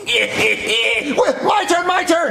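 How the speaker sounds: high shout, laughing; whiny demand. Acted. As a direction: yell like a kid who wants his turn. Kid demanding his turn.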